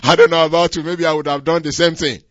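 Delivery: loud, quick, through laughter; laughing admission. Real, not acted. Preacher admitting he might have done the same, laughing. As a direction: burst out amused while confessing.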